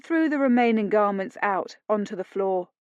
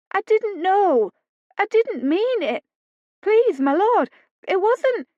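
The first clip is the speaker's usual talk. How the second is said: much higher, frightened pleading; pleading maid; 12 semitones higher, 6 dB louder than usual. A narrator's reading. Maid to her master, high scared pleading. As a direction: beg desperately, voice cracking. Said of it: "who quailed".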